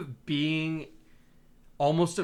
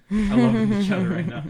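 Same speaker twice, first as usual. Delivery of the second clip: laughing; 8 semitones higher, 10 dB louder than usual. Real, not acted. Reading review, laughs.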